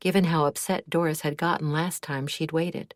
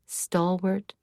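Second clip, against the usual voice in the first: ordinary delivery, nothing marked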